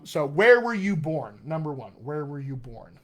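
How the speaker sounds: ordinary delivery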